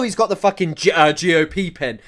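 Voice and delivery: ordinary talk, nothing marked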